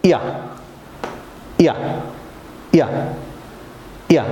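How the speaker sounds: ইয়া as a quick clipped "ya", repeated; Y sound drilled. Shown as a demonstration. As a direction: snap out ইয়া briefly each time. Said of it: "আপনারা আমার সঙ্গে বলুন".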